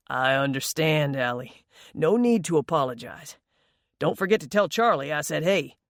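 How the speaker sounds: lightly voiced older man, easygoing; gruff man voice. A narrator's reading. Tom's voice in the story.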